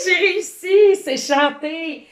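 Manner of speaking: loud, high, delighted win; triumphant cheer. Real, not acted. Teacher cheers her own win at the flyswatter game, loudly delighted. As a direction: crow over hitting the right word.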